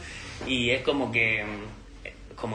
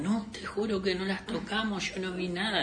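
higher, softer pleading denial; mock protesting innocence; 5 semitones higher, 6 dB quieter than usual. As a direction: imitate someone swearing he did nothing. Acting neighbour swearing innocence.

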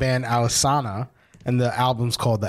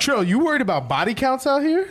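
teasing disbelief; 13 semitones higher than usual. Real, not acted. Teasing friend, repeating question.

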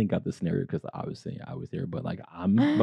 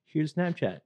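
higher, put-on British accent; mock British reply; 9 semitones higher than usual. Replying to the British friend, in a higher mock British accent. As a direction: answer in his British accent, playfully. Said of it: "I was like".

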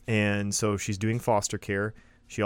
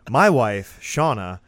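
My wife, Shauna, said louder with pride; proud loud announcement; 7 dB louder, slower than usual. Pretend. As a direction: boom it out like a proud husband. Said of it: "Jason always has this phrase".

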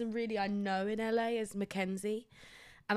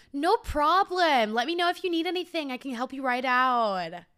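high, loud, fast gushing salesperson; bubbly clerk pressing to help; over-eager American sales patter; pushy cheerful shop assistant; 5 semitones higher, 7 dB louder than usual. Retold or imitated.

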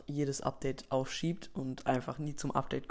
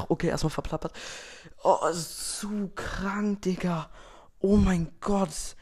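freaking out; 2 semitones higher, 3 dB louder than usual. Acted. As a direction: lose it completely over the update. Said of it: "der komplett ausrastet".

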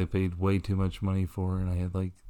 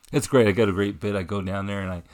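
normal talking voice

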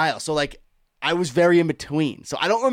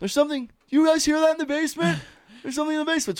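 much higher teasing dad voice; 13 semitones higher, faster than usual. Retold or imitated. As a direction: pitch up, ham up the mystery. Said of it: "my dad just goes".

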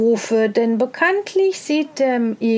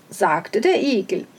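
ordinary delivery

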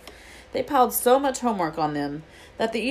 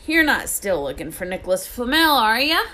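suspicious Hagrid; 5 semitones higher, 5 dB louder than usual. Acted.

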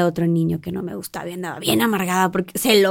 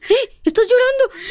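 high, surprised mock question; imitating her sister noticing her tears; high teasing quote; 14 semitones higher than usual. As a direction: tease someone caught crying. Quoting Amanda teasing.

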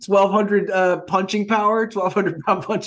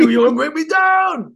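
Adrian quote; 7 semitones higher, 4 dB louder than usual. Retold or imitated. Adrian's beach scene, Rocky III.

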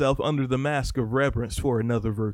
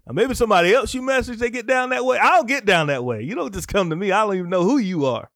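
higher, louder, indignant rebuff; worked-up refusal; 8 semitones higher, 5 dB louder than usual. Real, not acted. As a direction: get heated shutting down money requests. Rebuffing messages asking for money.

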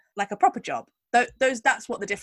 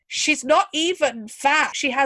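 higher-pitched, slow, emphatic protest; indignant protest; 6 semitones higher, 3 dB louder, slower than usual. Acted. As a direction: stretch it out in indignant disbelief. Acted indignant reaction to the photo.

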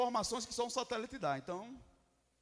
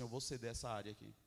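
low, hushed concession; soft, lowered humble yielding; humble surrender; 11 semitones lower, 11 dB quieter, faster than usual. Acted. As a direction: give way quietly, voice dropped low. Voices a humble surrender to God.

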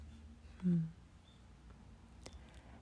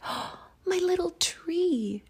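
much higher, delighted little whisper; excited whisper; 13 semitones higher than usual. Acted. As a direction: gasp it out in wonder. Girl remembers her tree.

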